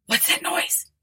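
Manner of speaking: sharp breathy hiss of alarm. Acted. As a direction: hiss the question under your breath. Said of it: "hissed Ford".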